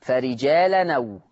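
فرجالا أو linked as farijaalanaw, hamza dropped; naql. Shown as a demonstration. Teacher repeating for students, فرجالا أو joined by naql. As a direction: slide the n of فرجالا straight into أو. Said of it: "بالنقل".

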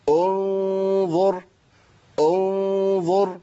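"انظر" slow, the opening hamza thick and dark; emphatic hamza error. Shown as a demonstration. Teacher imitating those who thicken the hamza: heavy hamza. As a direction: darken the first vowel of "انظر". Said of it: "ما أقولش انظر".

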